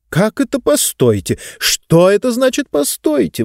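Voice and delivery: put-on high, indignant old lady voice; baffled, rising outrage; exclaiming. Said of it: "воскликнула пожилая дама".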